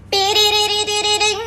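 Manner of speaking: slow, trilled ringing noise; imitated phone ring. Narrator mimicking the room phone ringing. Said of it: "a recepcionista liga".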